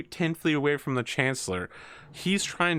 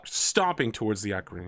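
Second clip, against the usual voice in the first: usual speaking voice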